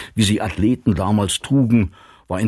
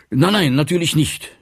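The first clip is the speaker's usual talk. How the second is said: higher, hurried backing-down; hasty reply; 7 semitones higher than usual. A narrator's reading. Wehrmann withdraws his request for a loan, hastily. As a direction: take the request back quickly. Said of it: "sagte Wehrmann rasch".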